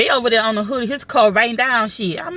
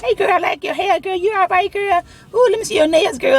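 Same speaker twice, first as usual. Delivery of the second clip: put-on high, sugary girlfriend voice; mock sweet girl talk; fake friendly girl; 9 semitones higher than usual. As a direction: gush like her instant new best friend. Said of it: "we best buddies".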